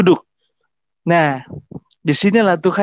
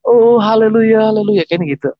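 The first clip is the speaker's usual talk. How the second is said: imitated tearful cry; 6 semitones higher than usual. Congregation crying out in prayer, imitated wailing praise. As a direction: imitate worshippers calling out in tears. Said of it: "mereka menangis. Menangis, teriak-teriak".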